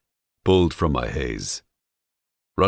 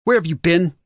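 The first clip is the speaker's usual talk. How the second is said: high, loud woman's voice bursting out; woman's voice blasting through; 6 dB louder than usual. Acted. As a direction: her frantic demand, pitched way up. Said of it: "I hear her voice blast through".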